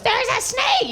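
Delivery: high mock squeal of fright; mock shriek. Little girl spotting a snake, high mock squeal. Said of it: "your little girl or your wife goes".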